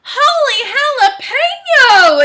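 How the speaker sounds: high, loud exclamation; loud, high-pitched storybook outburst; Skippy John Jones exclaiming. Acted. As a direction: cry it out in big cartoon amazement. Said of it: "exclaimed Skippy John Jones".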